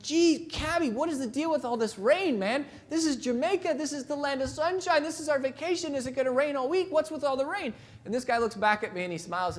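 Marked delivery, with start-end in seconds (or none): 0.0-7.7 high, whiny complaining tourist; mock whiny tourist. Tourist whines to the Jamaican cabbie about the rain, high and mock-petulant. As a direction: pitch it up and whine like a spoiled tourist. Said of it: "I'm yelling at the cabbie".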